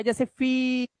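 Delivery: फीस with a long held ee. Shown as a demonstration. Teacher showing students the long vowel. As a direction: hold the ee in फीस. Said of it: "तेज लेना है, लंबा लेना है".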